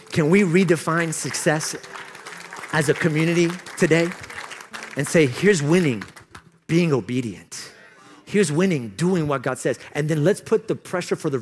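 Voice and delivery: punchy preacher's appeal; emphatic preaching. Real, not acted. Preaching with raised voice.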